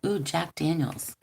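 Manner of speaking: usual speaking voice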